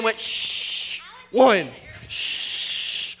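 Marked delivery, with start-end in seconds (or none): 0.2-1.0 long hissed "shhh" for running water; whooshing shower noise. Retold or imitated. Acts out a quick shower. 2.1-3.2 long hissed "shhh" for running water; whooshing shower noise. Retold or imitated. Acts out a quick shower.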